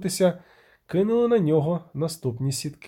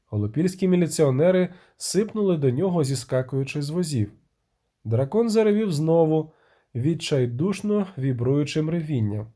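ordinary delivery, nothing marked

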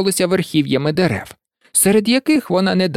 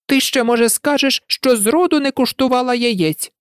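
higher, complaining bird voice; a weary mother pigeon griping; lightly voiced fretful pigeon; 7 semitones higher than usual. A narrator's reading. Pigeon voice held. (скаргливий голос голубки)

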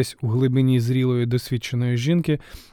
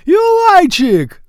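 long, high, loud delighted howl; joyful yell; 10 dB louder, much slower than usual. Acted. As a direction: bellow the name across the room. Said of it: "заволав дитина на весь Гінікей".

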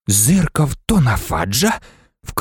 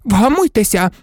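high-pitched reproof; scolding cry; 9 semitones higher than usual. A narrator's reading. Nurse telling the professor to calm down, indignant. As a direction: cry out to restore order. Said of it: "крикнула мадам Помфрі".

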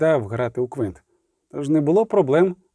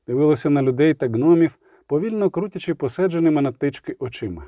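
usual speaking voice

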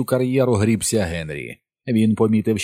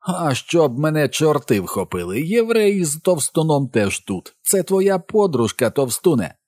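jeering taunt; 6 semitones higher than usual. A narrator's reading. Henry spots Ben and the others, jeering taunt. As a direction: jeer at them, stretching the words.

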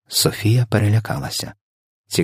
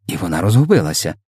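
ordinary delivery, nothing marked

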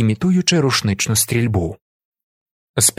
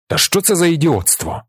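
raised pitch, exasperated question; indignant outburst; 6 semitones higher than usual. A narrator's reading. Kozole exploding at the boys' war game, indignant outburst. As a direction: burst out in disbelief. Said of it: "вибухає він".

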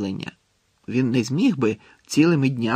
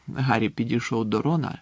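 ordinary delivery, nothing marked

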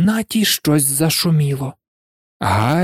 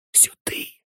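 barely breathed, unvoiced; soundless whisper; 13 dB quieter than usual. A narrator's reading. Harry silently guiding friends away from Filch, whispered. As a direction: whisper it so nobody hears. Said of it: "беззвучно підказав Гаррі".

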